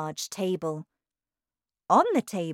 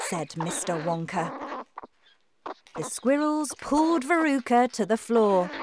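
usual speaking voice

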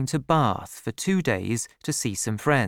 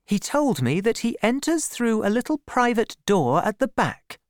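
much higher woman's voice; defensive Bathsheba; 10 semitones higher than usual. A narrator's reading. Woman excusing Troy to Gabriel, defensive. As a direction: explain defensively in a high, insistent pitch.

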